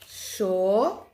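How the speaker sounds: "Cho" loud, drawn out and alone; isolated syllable. Shown as a demonstration. Teacher dividing a word for pupils, isolated syllable "Cho". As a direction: sound out "Cho" as one separate, emphatic syllable. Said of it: "dividir a palavra, olha lá".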